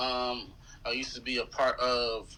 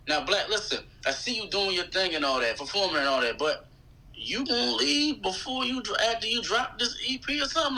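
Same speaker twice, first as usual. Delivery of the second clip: lightly voiced label mate, rising much higher at the end; 7 semitones higher than usual. Artist quoting his label mate's question, lightly imitated and rising high. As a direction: mildly act out a friend's probing question, climbing high. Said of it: "[name] asked me beforehand".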